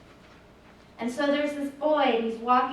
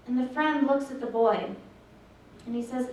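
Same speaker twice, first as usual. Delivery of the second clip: normal talking voice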